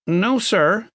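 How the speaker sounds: high-pitched, nervous reply; nervous man. A narrator's reading. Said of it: "stood up nervously".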